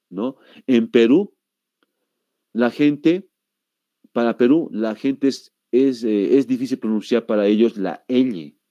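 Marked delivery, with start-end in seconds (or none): none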